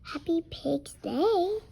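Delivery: usual speaking voice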